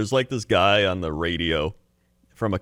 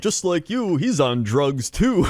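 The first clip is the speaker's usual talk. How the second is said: radio ad tag; 7 semitones higher, slower than usual. Retold or imitated. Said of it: "they're like".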